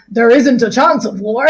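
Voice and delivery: a hint of a pompous father; lightly voiced blustering businessman; Mr Burling voice. Teacher voicing Mr Burling's confident speech, lightly pompous. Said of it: "I'm gonna do all my voices".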